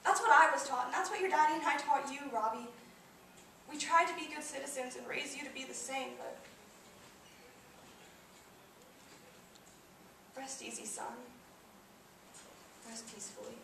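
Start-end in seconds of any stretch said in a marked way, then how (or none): 0.0-2.2 raised, higher voice of a grieving parent; pleading to justify yourself to your child; pained insistence. Acted. Grieving mother defends what she taught. 10.4-13.6 very soft, tender goodbye to a son; quiet graveside blessing; hushed farewell. Acted. Mother's farewell at son's grave.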